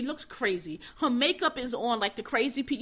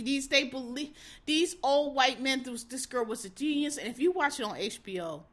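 normal talking voice

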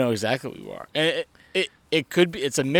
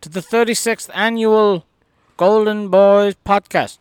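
announcer voice; 5 semitones higher, 4 dB louder than usual. Acted. Podcast intro announcement.

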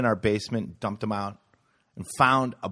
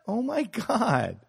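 mock kid voice; 9 semitones higher than usual. High accusing kid voice.

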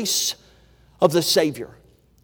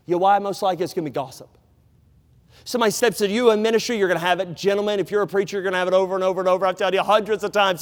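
ordinary delivery

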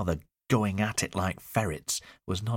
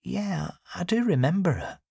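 confirming; 13 semitones higher than usual. A narrator's reading. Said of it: "she confirmed".

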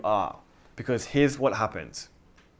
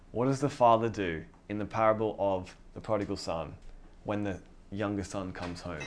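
normal talking voice

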